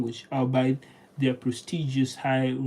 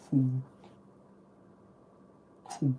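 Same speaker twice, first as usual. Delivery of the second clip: ordinary talk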